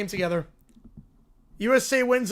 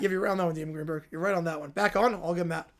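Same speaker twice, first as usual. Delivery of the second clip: usual speaking voice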